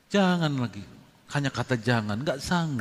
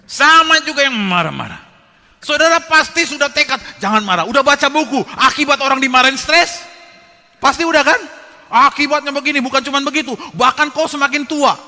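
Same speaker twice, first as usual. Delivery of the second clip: much higher, much louder sermon voice; preaching at full force; 10 semitones higher, 12 dB louder than usual. Acted. Preacher warning the congregation about anger, forceful. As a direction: drive the point home to the pews.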